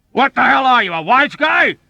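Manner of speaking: loud, high belligerent yell; yelling back. A narrator's reading. Dobbs answering a heckler in the ward, belligerent yell. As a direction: shout it back, spoiling for a fight. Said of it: "Dobbs yelled back".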